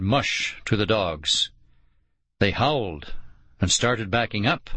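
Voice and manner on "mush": ordinary delivery, nothing marked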